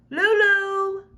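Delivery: high, ringing call across the forest; children calling out. A narrator's reading. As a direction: voice it up high and carrying. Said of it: "the school children called".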